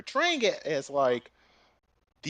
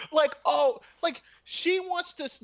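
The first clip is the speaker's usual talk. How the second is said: ordinary delivery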